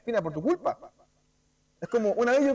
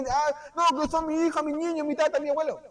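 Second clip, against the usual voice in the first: excusa aguda y burlona; esposa que se excusa; 7 semitones higher than usual. Retold or imitated. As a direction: imitando a la esposa. (imitación aguda de esposa excusándose)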